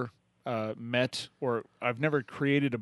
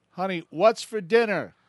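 called out higher, louder and slow toward the kitchen; husband calling out; 8 semitones higher, 7 dB louder than usual. Retold or imitated. Old husband calls out to his wife in the kitchen, raised voice. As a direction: raise your voice to call to the kitchen. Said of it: "And he goes".

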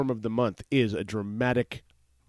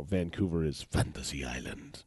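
a hint of announcer; 4 semitones lower, 5 dB quieter than usual. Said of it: "No one says".

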